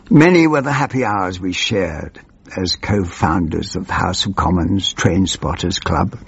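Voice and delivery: ordinary delivery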